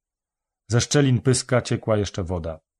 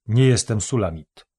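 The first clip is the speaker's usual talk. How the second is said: ordinary delivery, nothing marked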